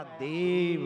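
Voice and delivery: देव loud and long-drawn; emphasis on देव. Shown as a demonstration. Preacher showing the word God added last, देव held loud and long. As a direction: swell and stretch देव.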